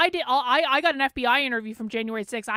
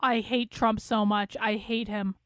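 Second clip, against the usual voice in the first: ordinary delivery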